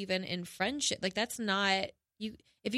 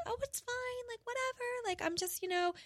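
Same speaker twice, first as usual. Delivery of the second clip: much higher and softer, mocking a dismissive shrug-off; mock brush-off; 5 dB quieter than usual. Mocking a friend who hides hurt, high soft dismissive voice. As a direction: toss it off in a high, soft put-on voice as if nothing matters. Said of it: "you can't just be like".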